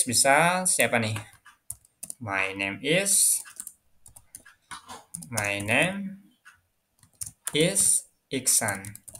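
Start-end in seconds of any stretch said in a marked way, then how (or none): 2.2-9.1 slow, drawn-out words with long gaps; word-by-word dictation. Real, not acted. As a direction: say it slowly as you write it out. Writing out the answer word by word. (didikte pelan kata demi kata)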